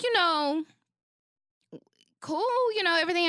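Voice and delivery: airy, high mock-casual; mock breezy. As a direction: toss it off high and light. Light casual voice pitched high.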